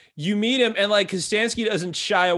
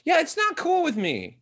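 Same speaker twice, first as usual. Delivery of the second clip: mock outrage; 11 semitones higher than usual. Real, not acted. Mock outrage about Giles villains.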